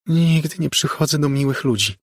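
put-on high child voice; eerie little girl. A narrator's reading. Ghostly girl informs Margo about her visits, high child voice. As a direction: inform coolly in a high girlish voice.

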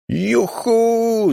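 high, drawn-out whoop; loud whoop. A narrator's reading. Jim whooping on a wild drive, high joyful call. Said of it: "zawołał głośno".

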